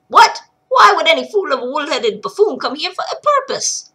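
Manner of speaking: loud indignant brogue; scornful dwarf. Acted. Miner scoffing at the girl, indignant. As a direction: scoff at the fool.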